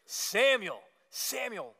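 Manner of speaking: high, ringing call that falls on each name; crying out. Acted. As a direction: call out the name like God summoning someone. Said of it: "the voice of God cries out".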